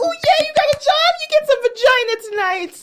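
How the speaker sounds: very high, hammed-up cheering; mock congratulating wife. Wife rewarding husband for his job, mock thrilled. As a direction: squeal mock praise at him. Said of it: "So she was like".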